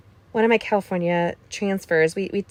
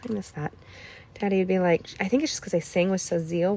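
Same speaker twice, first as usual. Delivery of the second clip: ordinary talk, nothing marked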